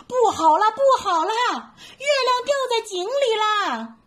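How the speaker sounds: high, loud frightened yell; scared shout. Acted. Big monkey frightened by the moon in the well, loud alarmed shout. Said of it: "也吓得大声叫起来".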